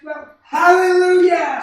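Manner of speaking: very loud, high, near-crying cry of relief; ecstatic shout. Acted. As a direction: burst out in tearful joy. Daughter has no fever.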